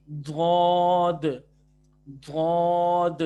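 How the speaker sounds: دھود drawn very slowly and loud, th-sound; spelled-out dhood. Shown as a demonstration. Tutor spelling out the sound letter by letter. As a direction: spell it, then stretch دھود long. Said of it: "ٹی ایچ جو sound ہے نا".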